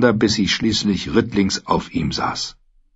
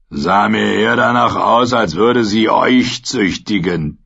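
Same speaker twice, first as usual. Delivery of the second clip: mocking mercenary. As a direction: sneer at the knight. Nasenloser spottet über James. (spöttischer Söldner)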